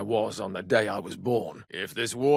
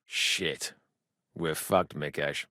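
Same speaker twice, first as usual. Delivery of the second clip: low, soft and defeated; lower, softer resignation; despairing mutter; 9 semitones lower, 7 dB quieter, slower than usual. Acted. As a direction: sink into quiet despair. Despair after hopeless news.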